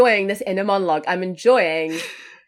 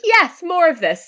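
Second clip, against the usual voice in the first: slow, high, loud delighted cheer; excited; 11 semitones higher, 5 dB louder than usual. Retold or imitated. Cheering the killer's monologue, slow high delight. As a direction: savour it like a fan getting more. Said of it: "It's like".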